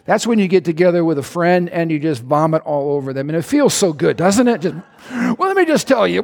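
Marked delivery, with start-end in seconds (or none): 5.1-6.2 mock rant. Acting out a rant to friend.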